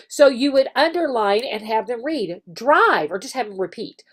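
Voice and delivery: ordinary talk, nothing marked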